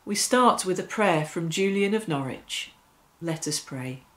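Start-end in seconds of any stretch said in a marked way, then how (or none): none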